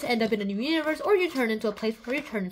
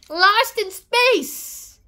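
higher and louder, drawn out slowly; excited title call; 6 semitones higher, 5 dB louder, much slower than usual. Acted. Kid excited about the TV show, calling out its title. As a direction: announce the show title with gusto.

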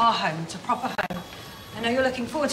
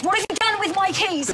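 accusing; 7 semitones higher than usual. Acted. Father losing his keys, panicking.

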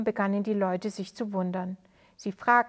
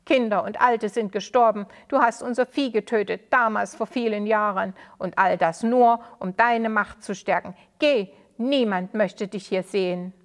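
loud, bitter reproach; angry accusation; 2 semitones higher, 6 dB louder than usual. Acted. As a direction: drive him out with your words. Townsman keeps accusing the old man.

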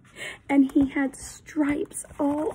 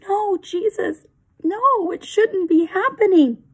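higher and louder, pleading; anguished plea; 7 semitones higher, 8 dB louder than usual. Acted. Benjamin pleads with Jesus, high and anguished. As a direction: beg it not to be so.

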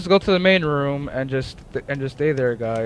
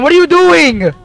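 shocked yell, much higher; alarm shout; 14 semitones higher, 11 dB louder than usual. Real, not acted. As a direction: yell it in disbelief. Teammate about to die.

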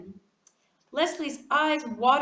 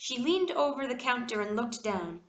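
ordinary delivery, nothing marked

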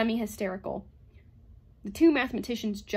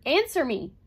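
loud, higher-pitched demand; forceful, raised-voice command; angry roar; 5 semitones higher, 7 dB louder than usual. A narrator's reading. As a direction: roar the order at him. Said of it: "roared Bogart".

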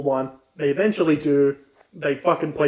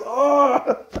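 high, loud mock realization; light-bulb moment; realizing oh; 11 semitones higher, 4 dB louder than usual. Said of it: "he goes".

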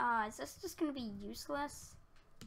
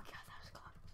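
breathless whisper of relief; 11 dB quieter than usual. Real, not acted. Player just escaping danger, whispering relief. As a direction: let it out as a hushed sigh. Relief after near death.

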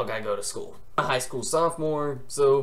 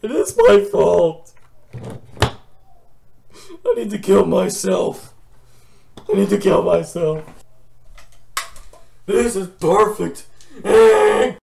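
loud, high, hammed-up wail of self-pity; mock despair; 5 semitones higher, 8 dB louder than usual. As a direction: overplay the meltdown for laughs. Melodramatic despair over blame.

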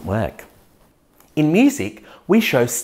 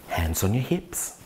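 ordinary talk, nothing marked

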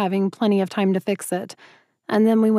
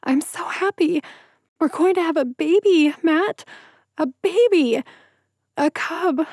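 much higher, crying through joy; tearful joyful blubbering; blubbering happy tears; 8 semitones higher than usual. Acted. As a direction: sob it out, overwhelmed with happiness. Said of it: "I blubbered".

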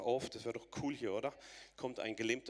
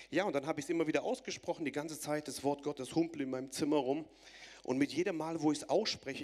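fast, loud, rising sermon climax; preacher firing up the crowd, fast and loud; preaching at full force; 4 semitones higher, 4 dB louder than usual. Acted. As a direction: drive it home to the congregation.

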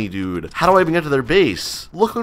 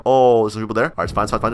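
ordinary delivery

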